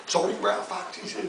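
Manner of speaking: usual speaking voice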